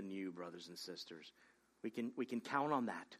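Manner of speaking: soft, low, intimate sermon voice; hushed preaching. Real, not acted. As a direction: draw the congregation close with a hushed tone.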